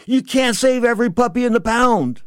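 high, loud, emphatic retort; frustrated. Retold or imitated. Boxer to his crying friend in the van, frustrated. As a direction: hammer it out with frustration. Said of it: "He was a little frustrated".

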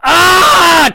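loud high crowd ah; mock crowd cry; crowd groan. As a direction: shout out like a crowd that knows. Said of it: "And then they're like".

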